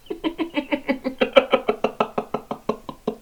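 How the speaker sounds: long high stuttering laugh; mocking villain cackle; cruel giggle. Machine laughs at captive's lost wife, cruel giggle.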